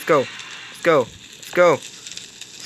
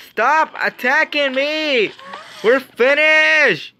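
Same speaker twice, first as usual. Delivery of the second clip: angry yelling; 6 semitones higher, slower than usual. Real, not acted. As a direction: yell at the traitor, dragging it out. Ally killed him, angry.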